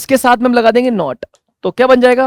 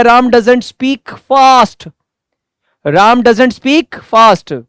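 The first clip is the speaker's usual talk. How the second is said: louder, still slow and drawn out; loud slow demo; 3 semitones higher, 5 dB louder, much slower than usual. Shown as a demonstration. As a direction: boom it out word by word. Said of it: "मजा आता है जब मैं चिल्ला के बोलता हूं". (ज़ोर से, धीरे-धीरे हर शब्द पर ज़ोर)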